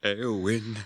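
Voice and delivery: urgent brotherly call. Acted. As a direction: call his sister back. Brother calls sister awake.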